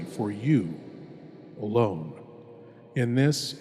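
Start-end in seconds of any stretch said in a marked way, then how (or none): none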